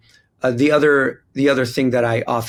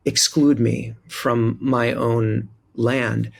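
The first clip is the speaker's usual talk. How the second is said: usual speaking voice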